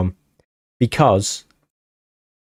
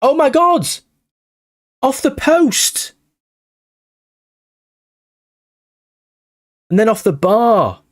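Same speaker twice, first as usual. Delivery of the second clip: much higher, shouting in disbelief at the post; real shock as the shot hits the woodwork; excited outburst; 12 semitones higher than usual. Real, not acted.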